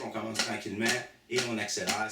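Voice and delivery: ordinary delivery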